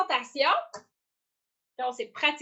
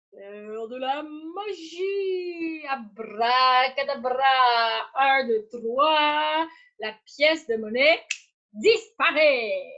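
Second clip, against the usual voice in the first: slow, grand magician voice; stage magician; 3 dB louder, much slower than usual. Acted. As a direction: announce the trick with mystery. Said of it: "j'ai mis mon chapeau de magicien".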